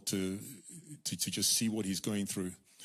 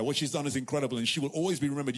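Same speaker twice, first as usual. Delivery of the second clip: normal talking voice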